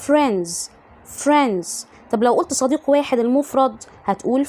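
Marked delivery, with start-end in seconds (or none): 0.1-1.7 FRIENDS as "frenz", no d; silent D. Shown as a demonstration. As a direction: model "friends" without its d. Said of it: "ده النطق الصح". (نطق friends من غير دي)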